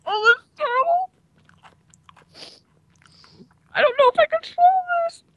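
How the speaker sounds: high, strained cry of pain. Real, not acted. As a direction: wail from the burning heat. First bite of very spicy food.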